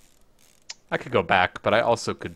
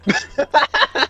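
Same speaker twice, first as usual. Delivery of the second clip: high laugh; 4 dB louder than usual. Real, not acted. Laughing at the jump.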